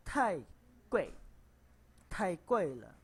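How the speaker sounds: "Tai gui le" with loud, sharply falling syllables; falling tones. Shown as a demonstration. Teacher giving an example phrase in fourth tone. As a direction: stab each syllable downward.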